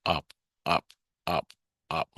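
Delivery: lightly imitated inner voice, short separate repeats; one-word talker. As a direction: say it as isolated single words. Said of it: "he only says one word".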